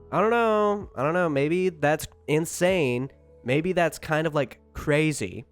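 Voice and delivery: high, drawn-out sarcastic musing; mock innocent wondering. Real, not acted. Sarcastic mock doubt about Facebook.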